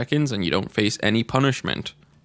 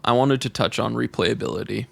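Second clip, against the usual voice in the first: ordinary talk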